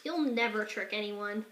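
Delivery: plain reading voice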